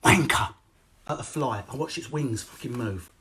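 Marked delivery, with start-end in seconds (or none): 0.0-0.5 sudden loud snap; abrupt loud insult; dad's curse. Retold or imitated. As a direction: bark it at the fly. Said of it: "and said".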